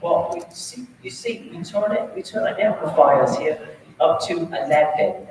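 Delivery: put-on terrible British accent. Band director imitates a Spinal Tap rocker in a bad British accent. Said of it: "I'm gonna do a terrible accent".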